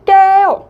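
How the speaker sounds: 깨우 said high and loud, the 우 held long; stress on 깨우. Shown as a demonstration. Teacher to Thai learners, stressing 깨우 high and loud. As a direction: lean hard into 깨우, stretch the vowel. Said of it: "깨우 우 부분에 좀 더 강세를".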